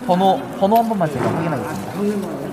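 high polite staff voice; bright courteous helper; customer service voice. Real, not acted. Staff fitting wristbands at entrance.

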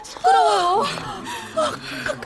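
high, loud shaky calls; shaken calling out. Acted. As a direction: call out, still rattled from the fight. Calling to beaten neighbour after fight. (높고 크게 놀란 채 부르기)

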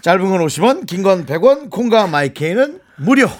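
bright, punchy promo read; announcer read. Acted. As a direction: rattle it off like a radio ad. Announcer read of text info.

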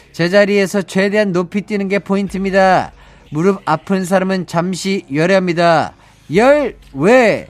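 barking army drill instructor; drill sergeant. Acted. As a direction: bark orders like a boot camp drill sergeant. Fitness drill segment voice.

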